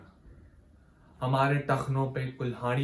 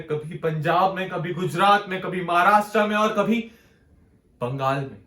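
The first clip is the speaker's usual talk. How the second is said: rising louder and higher through the list; building recitation; 5 semitones higher, 7 dB louder than usual. Acted. Poet listing riot-hit states to listeners, mounting intensity. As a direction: mount the intensity with each place named.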